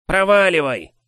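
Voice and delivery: high loud growl. Acted. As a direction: snarl him away. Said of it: "прорычал босс".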